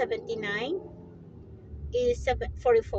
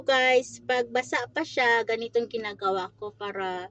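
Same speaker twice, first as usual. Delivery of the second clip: ordinary delivery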